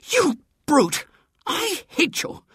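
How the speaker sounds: very high, furious outburst. A narrator's reading. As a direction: fling it at him in high-pitched fury. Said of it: "Marian said furiously".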